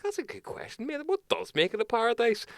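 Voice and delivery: high mock-impressed reply; mock amazement. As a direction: pitch it way up, as if hooked by the question. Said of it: "I was like".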